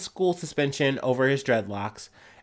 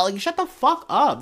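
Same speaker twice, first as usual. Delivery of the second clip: a real flash of anger, much higher; heated; 10 semitones higher than usual. Real, not acted. To the school, heated and much higher. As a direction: snap it out, fed up and heated. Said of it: "I'm kinda getting heated a little bit".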